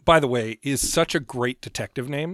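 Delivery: ordinary talk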